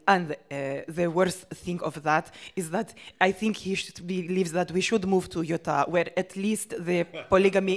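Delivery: put-on lightly Jordanian-accented English. Hela answering the interviewer in her Jordanian accent. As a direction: stay stuck in Hela's Jordanian voice. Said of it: "another person is coming out".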